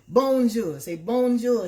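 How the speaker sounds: Bonjour called high and loud, coaxing; French greeting. Shown as a demonstration. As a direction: teach the bowl to say Bonjour. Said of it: "That means hello".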